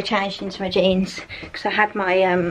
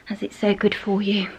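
normal talking voice

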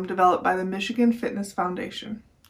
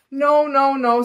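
loud, higher rooster protest; firm no; 3 semitones higher, 7 dB louder, slower than usual. A narrator's reading. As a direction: stop them, loudly. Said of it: "said Rooster".

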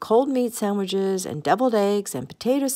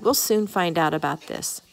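ordinary delivery, nothing marked

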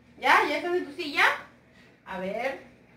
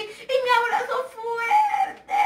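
much higher, slow, squealing shout of delight; gleeful shout; 11 semitones higher, slower than usual. Real, not acted. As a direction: squeal out the big hug. Big hug to the kids. (grito agudo y lento de alegría por el abrazo fuerte)

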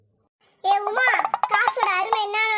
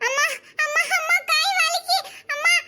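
put-on high child voice calling amma; child crying for mother; 7 semitones higher, 3 dB louder than usual. Child dragged away, calling for amma high. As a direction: plead like a small kid being dragged.

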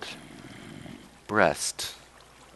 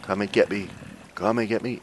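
normal talking voice

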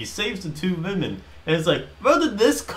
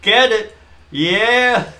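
loud rising cheer, building higher; 3 semitones higher, 8 dB louder, much slower than usual. Retold or imitated. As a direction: cheer him on like a fan. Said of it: "I'd be like".

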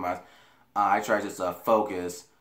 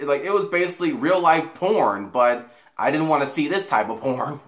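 excited; 6 semitones higher, 6 dB louder than usual. Real, not acted. Excited laughing retelling.

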